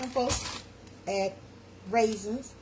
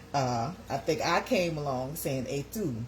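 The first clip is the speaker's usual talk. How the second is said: usual speaking voice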